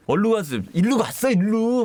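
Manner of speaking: high, impatient retelling; urgent insistence. Retold or imitated. Witness telling the cop which way it went, urgent. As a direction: imitate a witness urgently pointing the way.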